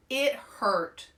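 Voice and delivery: usual speaking voice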